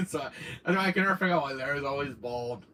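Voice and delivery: ordinary talk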